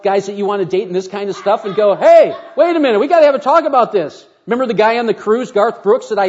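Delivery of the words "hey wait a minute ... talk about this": higher, louder, calling a halt; mock confrontation. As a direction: stop him short, half-joking. Pastor confronting a dating guy.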